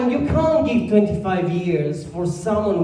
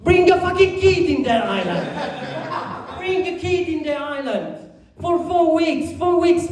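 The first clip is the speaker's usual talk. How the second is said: exasperated rant; 10 semitones higher than usual. Real, not acted. Exasperated ranting punchline.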